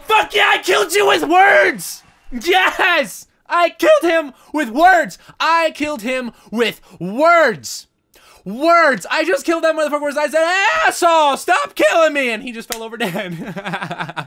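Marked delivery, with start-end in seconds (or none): 0.1-10.2 loud, high victory yell; triumphant shouting. Real, not acted. Celebrates beating the boss. 10.8-12.3 loud, high mock-angry yell; shouted re-enactment. Re-enacts his taunt, gleefully.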